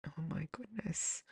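slow, breathless whispered gasp of shock; hushed horrified whisper. Retold or imitated. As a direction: whisper it as the truth sinks in. Recalling shocked reaction.

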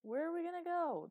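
high, loud incredulous question; amused incomprehension. Acted. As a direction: pitch it way up, not taking it seriously. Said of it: "Eli laughed a little, obviously not understanding".

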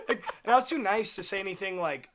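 ordinary talk, nothing marked

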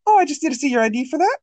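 very high, fast sugary cashier voice. Retold or imitated. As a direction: imitate an over-sweet princess working the till.

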